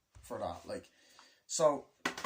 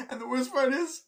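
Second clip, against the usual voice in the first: high, louder, squealing through laughter; cracking up at their own bad luck, voice jumping high; laughing squeal; 9 dB louder than usual. Laughing disbelief at disaster.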